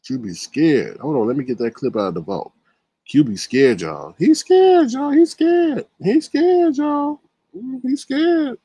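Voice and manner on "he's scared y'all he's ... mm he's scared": high, loud mocking chant; singsong teasing. Mocking chant to viewers about scared Q B. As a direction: tease him in a high singsong.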